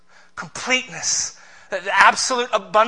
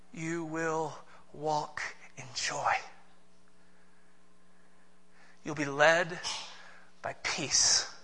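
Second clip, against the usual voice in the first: soft, low and slow; quiet, intimate promise; hushed; 3 semitones lower, 12 dB quieter, slower than usual. Acted. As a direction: let it fall to a near whisper.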